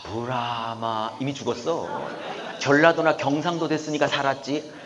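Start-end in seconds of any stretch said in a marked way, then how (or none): 0.0-1.2 slow drawn-out 아브라함아 in Chungcheong dialect; Chungcheong drawl. Shown as a demonstration. Preacher joking that a Chungcheong God calls too slowly, Chungcheong drawl. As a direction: stretch 아브라함아 lazily like a Chungcheong speaker. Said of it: "충청도 출신 하나님이었으면 이미 죽었어".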